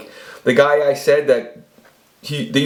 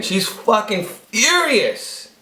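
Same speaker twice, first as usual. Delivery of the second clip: drawn-out words leaping high; dramatic emphasis; 8 semitones higher, much slower than usual. Retold or imitated. Storyteller hamming up how angry she was, slow and high.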